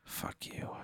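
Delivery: barely voiced, under the breath; whispered curse. Real, not acted. Player cursing at the game master's twist, whispered. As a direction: whisper it as a private aside. Muttered aside during the story.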